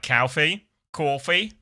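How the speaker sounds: coffee as a New Yorker says it, with a drawn-out "aw" vowel; New York "coffee" with a broad "aw" vowel, rising into a question; New York accent. Shown as a demonstration. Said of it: "like I'm a New Yorker ordering a coffee".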